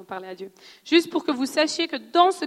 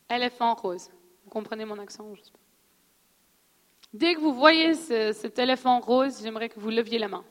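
guided visualization; 4 semitones lower than usual. Acted. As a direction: lead the room gently into picturing it. Leads a visualization exercise.

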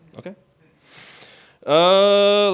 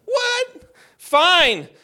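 mom voice; 7 semitones higher, slower than usual. Retold or imitated. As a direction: play the fed-up mom. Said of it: "she's like".

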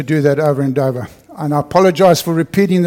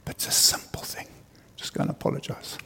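whispered, much softer prompting; 12 dB quieter than usual. Acted. The Spirit of God prompting him, whispered. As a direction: breathe it like a gentle inner nudge.

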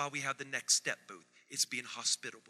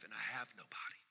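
whispered lament; 6 semitones lower, 13 dB quieter than usual. Retold or imitated. Retelling old man's lament.

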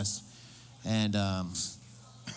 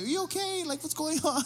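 concerned; 14 semitones higher, 3 dB quieter than usual. Retold or imitated. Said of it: "I was like".

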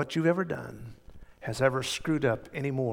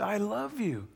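higher, softer, mildly exasperated reply; a little irritated; 7 semitones higher, 6 dB quieter than usual. Retold or imitated. Peter answering Jesus the third time, a little irritated. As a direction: imitate someone slightly irked at being asked. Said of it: "was a little irritated".

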